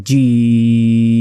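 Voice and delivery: জিম stretched long; চার second টেনে জিম; held four seconds. Shown as a demonstration. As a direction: draw out জিম slowly. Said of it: "চার second টেনে পড়বেন".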